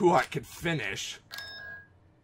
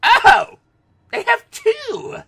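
surprised; 6 dB louder, slower than usual. Real, not acted. Surprised by enemy forces.

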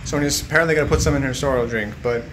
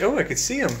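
ordinary delivery

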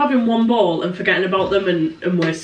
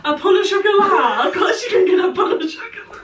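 much higher laughing mock clerk; hammed-up shop clerk; 9 semitones higher, faster than usual. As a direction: overdo the cheerful baker's welcome. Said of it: "Say seriously".

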